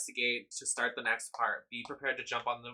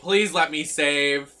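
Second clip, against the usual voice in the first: slow, louder and higher plea; pleading; 4 semitones higher, 10 dB louder, slower than usual. Real, not acted. Gamer begging the game to let her save. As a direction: beg the game desperately.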